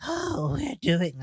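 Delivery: high, failed go at creature whispering; attempted whispering voice. Co-host imitating the movie's little things, failed whispering voice. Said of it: "I can't do their whispering voice".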